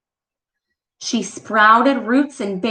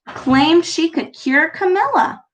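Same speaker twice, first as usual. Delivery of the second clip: ordinary delivery, nothing marked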